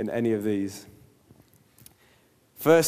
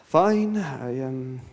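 guilt tripper; 6 semitones higher than usual. Retold or imitated. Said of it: "Say things like".

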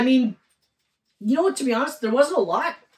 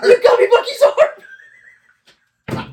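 much higher, louder, slow drawn-out cry; excited shout; 10 dB louder, much slower than usual. Retold or imitated. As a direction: squeal it out like an overjoyed kid. Acting out an excited gift reaction.

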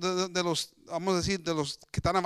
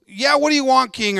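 loud, high, insolent mock retort; bad attitude; 6 semitones higher, 12 dB louder than usual. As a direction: throw it at the king with attitude. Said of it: "with a bad attitude".

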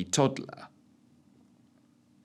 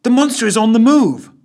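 high, louder warning call; urgent call to the crew; urgent alert; 12 semitones higher, 9 dB louder than usual. A narrator's reading. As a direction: sound the alarm to the others. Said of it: "alerted Ellen".

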